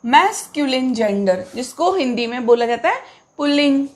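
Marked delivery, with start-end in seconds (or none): 0.0-0.9 "मैस्कुलिन" ending in a short "लिन", not "लाइन"; teacher's model of "मैस्कुलिन", last syllable rhyming with "bin"; correct pronunciation. Shown as a demonstration. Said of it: "इसको हम क्या बोलेंगे".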